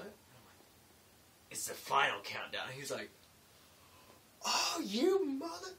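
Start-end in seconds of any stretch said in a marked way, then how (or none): 1.5-2.6 "final" punched loud; punchline stress on final. Retold or imitated. Springing the joke on a coworker, "final" punched loud. 4.5-5.7 high, loud, drawn-out mock outrage; yelling. Coworker tricked by the joke, imitated outraged yell. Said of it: "he started just yelling at me and laughing".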